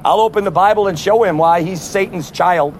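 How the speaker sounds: usual speaking voice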